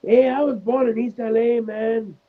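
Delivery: high-pitched laid-back stoner impression; Cheech. Quoting Cheech protesting his deportation, high mock protest. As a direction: put on a high comic East L A voice. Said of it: "Cheech is like".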